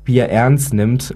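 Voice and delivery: ordinary talk, nothing marked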